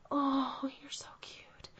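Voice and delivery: put-on soft whispered baby talk; whispered coo. Cooing at baby.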